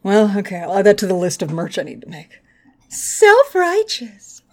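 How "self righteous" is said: sung out very high and slow; high, slow mock announcement; high drawn-out slogan. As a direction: stretch it like a sparkly tagline.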